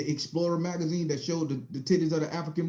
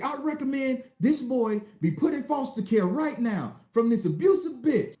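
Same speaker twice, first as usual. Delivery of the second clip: outraged demand; 9 semitones higher than usual. Retold or imitated. Official demands foster care.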